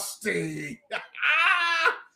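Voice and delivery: loud, high burst of laughter; big laugh. Real, not acted. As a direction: howl with laughter at the dunk. Laughing after the dunk.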